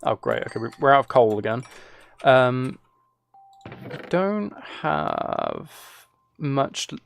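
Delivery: ordinary talk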